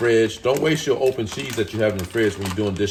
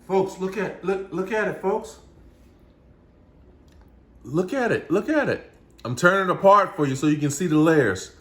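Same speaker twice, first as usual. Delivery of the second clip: much higher, urgent showing off; excited; 9 semitones higher, 4 dB louder than usual. Real, not acted. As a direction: insist the folks look, thrilled. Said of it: "I get excited about this".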